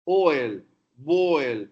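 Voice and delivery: ordinary talk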